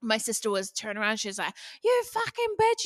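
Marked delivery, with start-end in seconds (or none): 1.8-2.8 retold yelling. Sister turns round on the rude cyclist, imitated high yell. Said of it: "you know, yelling".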